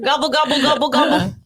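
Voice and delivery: high gobbling turkey voice; turkey impression. Shown as a demonstration. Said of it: "That's my turkey impression".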